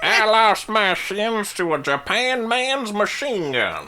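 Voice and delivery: put-on high, slow old man drawl; crotchety war vet complaining; cranky old veteran. Quoting Cotton's war stories.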